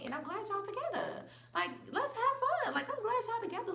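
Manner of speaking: giggly and glad, brushing it off; high laughing reassurance. Retold or imitated. Telling her ex and her friend she's glad, high and laughing.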